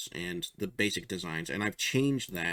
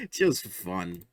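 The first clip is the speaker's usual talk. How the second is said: ordinary delivery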